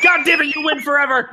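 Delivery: mock outrage. Real, not acted.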